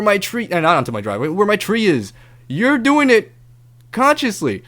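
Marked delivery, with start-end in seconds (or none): 2.5-4.6 accusing. Retold or imitated. Accusing neighbor in retold argument.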